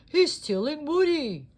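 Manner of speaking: high, loud urgent shout; alarmed shout. A narrator's reading. Buzz at the window, high loud alarm. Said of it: "cried Buzz Lightyear".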